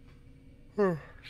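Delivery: long audible yawn. Real, not acted. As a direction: let out a big tired yawn. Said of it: "I always yawn around this time".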